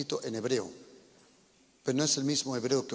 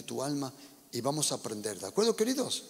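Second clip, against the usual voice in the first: normal talking voice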